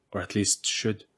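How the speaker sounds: ordinary delivery, nothing marked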